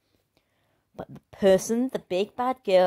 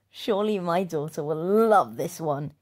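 usual speaking voice

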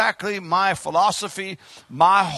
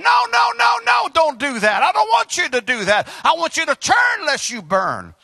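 high, raised cry of protest; pleading protest as God; 6 semitones higher than usual. Acted. God protesting in heaven to his people, urgent cry. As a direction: call out urgently, pitched up.

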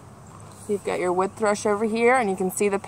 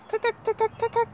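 high, quick cuck cuck calls; woodpecker call; 12 semitones higher, 5 dB quieter, faster than usual. Acted. Reading a bird book to kids, high woodpecker calls. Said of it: "Woodpecker calls from a tree".

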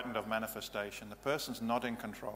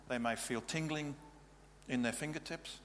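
ordinary talk, nothing marked

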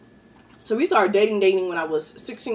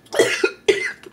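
harsh choking cough, breath forced out. Real, not acted. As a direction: cough hard like wine went down wrong. Choking on wine.

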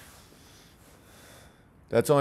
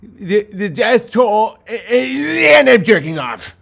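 slow mock voice, higher and louder; sneering imitation of posters; mocking quote; 8 semitones higher, 8 dB louder, slower than usual. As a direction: mimic people online with scorn. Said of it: "people ... are just like".

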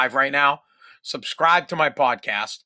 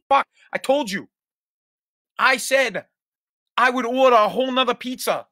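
raised, higher, exasperated retort; mock angry snap; 8 semitones higher than usual. Customer snapping at friend over ordered pizza, exasperated. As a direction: play the fed-up customer snapping back. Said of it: "You look at 'em and you go".

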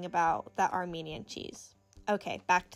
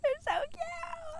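very high, drawn-out squeal; tiny high gush; cuteness squeal; slower than usual. Real, not acted. Gushing over the finished piece.

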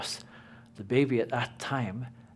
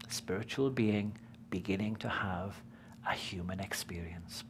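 hushed; 6 semitones lower, 8 dB quieter than usual. Real, not acted. Marvelling at baby's discovery.

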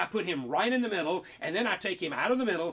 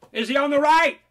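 much louder, higher question; loud quiz question; 6 semitones higher, 11 dB louder than usual. Acted. Quizzing the child where the frog is, loud question. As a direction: call it out to challenge the child.

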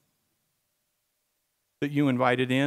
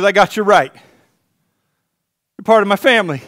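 reassuring God; 3 semitones higher, 7 dB louder, slower than usual. Acted.

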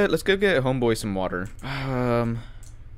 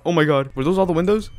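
usual speaking voice

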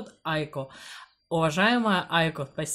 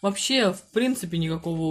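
talking with mouth full; speaking between bites; chewing food; 2 semitones higher, faster than usual. Real, not acted. Seen 0: Woman chewing after spoonful at -2s; unsure which person speaks.

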